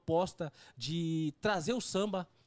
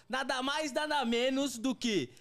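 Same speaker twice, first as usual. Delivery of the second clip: announcer intro; 6 semitones higher, slower than usual. Acted. Host announces the guest.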